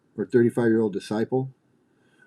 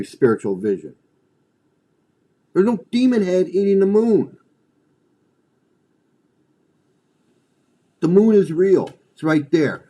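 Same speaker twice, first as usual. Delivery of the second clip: loud, higher, mocking disbelief; incredulous yell; 4 semitones higher, 5 dB louder than usual. Friends yelling at a cult believer, loud and incredulous. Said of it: "They would have said".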